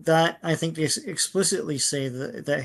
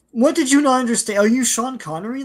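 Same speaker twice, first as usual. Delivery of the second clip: exasperated; 8 semitones higher, 4 dB louder than usual. Real, not acted. Mock-exasperated rant at the comic.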